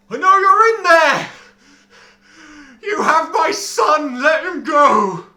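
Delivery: full-force, slow, desperate roar; furious shout. Acted. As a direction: bellow it at the locked gate. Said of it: "shakes them and goes".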